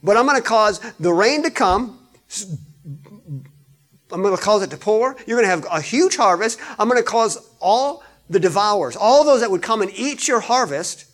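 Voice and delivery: high, loud booming declaration; preacher voicing God, loud and high; God's loud promise. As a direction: proclaim it like God promising plenty. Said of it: "He's saying".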